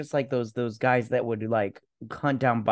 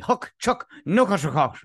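mock Klingon; 6 semitones higher than usual. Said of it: "sounds like Klingon to me".

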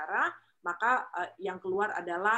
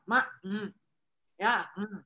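gloomy greeting; slower than usual. Shown as a demonstration. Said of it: "Jadi jangan".

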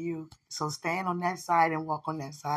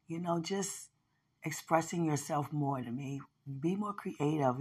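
normal talking voice

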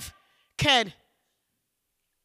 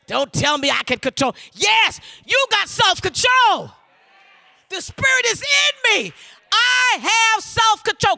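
full-force preaching. Real, not acted. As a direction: drive it home to the congregation.

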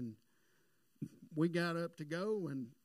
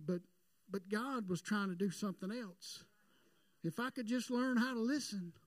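full-force preaching; 6 semitones higher than usual. Acted. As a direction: preach it out, climbing to the peak.

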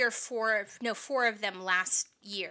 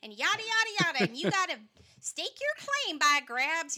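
higher-pitched mock Wild West guy; put-on saloon character; 8 semitones higher than usual. Shown as a demonstration. Teacher demonstrating her episode character, put-on saloon voice. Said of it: "he's gonna do this".

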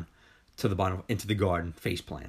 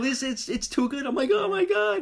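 high-pitched, squeaky mock panic; retold panic. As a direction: replay an overwhelmed freak-out. Replaying own panicked inner reaction.

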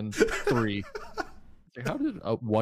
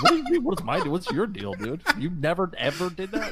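high, loud baffled disbelief; 1 incredulous; 9 semitones higher, 5 dB louder than usual. Retold or imitated. Retelling coworkers, answering in shocked high disbelief. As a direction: squeal it like you can't believe them.

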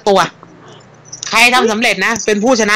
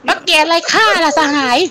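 theatrical reply; 6 semitones higher than usual. Acted. Acting the sun replying theatrically.

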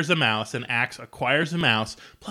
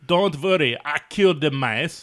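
put-on German accent, pitched up; Hans, German accent; 2 semitones higher, 3 dB louder than usual. Podcaster quotes guy at pool party, mock German accent. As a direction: play a comic German man with a thick accent. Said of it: "some guy named Hans is like".

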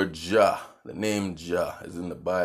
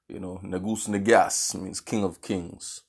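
ordinary talk, nothing marked

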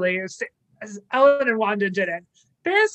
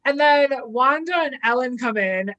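ordinary talk

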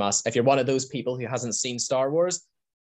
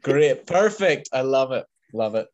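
delighted cheer; 2 semitones higher, 6 dB louder than usual. Real, not acted. Host reacting to friend's story, louder delighted cheer. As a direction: cheer it out, thrilled.

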